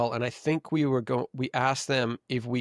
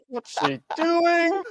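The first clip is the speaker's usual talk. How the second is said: baffled thought; 4 dB louder, much slower than usual. Retold or imitated. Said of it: "I remember thinking".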